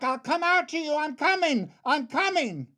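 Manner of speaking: high-pitched, urgent panicked call; yelling back. Acted. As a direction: call out frantically to someone in danger. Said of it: "And I yelled back".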